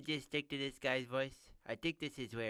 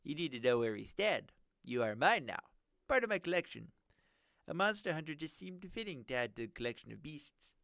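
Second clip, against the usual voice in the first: nerdy villain voice; 6 semitones higher, 6 dB louder than usual. Acted. Villain gloats over captive.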